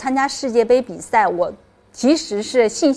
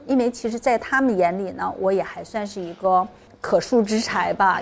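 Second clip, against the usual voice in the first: normal talking voice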